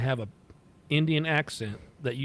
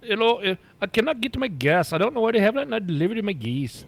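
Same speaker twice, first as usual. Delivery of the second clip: higher voice, mock Indian accent; lightly imitating an Indian accent on the phone; put-on Indian accent; 6 semitones higher than usual. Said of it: "so I put the accent on".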